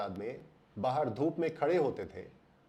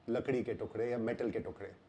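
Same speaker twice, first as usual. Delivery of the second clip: normal talking voice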